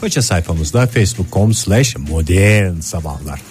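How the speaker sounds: slow, measured station announcement; radio announcer. Acted. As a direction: read it like an on-air promo. Radio host announcement read. (radyo spikeri anons sesi)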